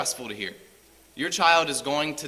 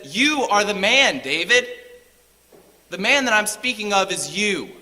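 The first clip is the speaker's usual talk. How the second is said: stern accusation; 5 semitones higher, 6 dB louder than usual. Retold or imitated. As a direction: point the finger and pronounce the verdict. Nathan accuses David.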